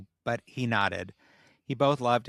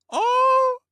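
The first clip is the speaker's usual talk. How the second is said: a sudden, much higher and louder "oh" that breaks off the reading; startled outburst; 8 dB louder than usual. Real, not acted. Reading fanfic aloud to friends, she yelps in surprise. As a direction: yelp as the text catches you off guard. Reader bursts out mid-sentence.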